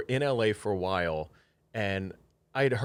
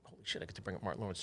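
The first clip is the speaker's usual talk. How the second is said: much softer, fast, hushed thrill; hushed excitement; 14 dB quieter, faster than usual. Retold or imitated. Reading the napkin before introducing Martin, hushed excitement. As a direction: rush it under your breath. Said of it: "you're like".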